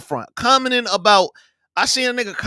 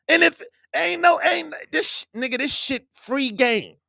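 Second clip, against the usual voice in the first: ordinary talk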